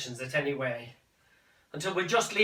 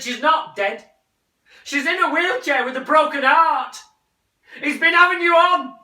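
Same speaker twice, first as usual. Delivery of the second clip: loud rising shout; 12 semitones higher, 11 dB louder than usual. Acted. Son shouting the truth at his mother. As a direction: shout it at her.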